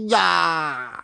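clucking, drawn-out hen voice; angry hen. Acted. Hen looming over the bumblebees, angry cluck.

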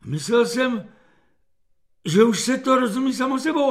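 high-pitched excuse, ending in a rush; apologetic. A narrator's reading. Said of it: "omlouval se Kefalín". (omluvně, vyšším hlasem)

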